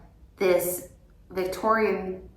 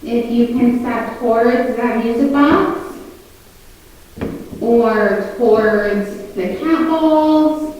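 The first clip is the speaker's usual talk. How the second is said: louder, higher, spaced-out invitation; calling out to spirits; 4 semitones higher, 7 dB louder than usual. Real, not acted. Ghost hunter inviting any spirit to respond, calling out. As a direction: project into the room, pausing between phrases.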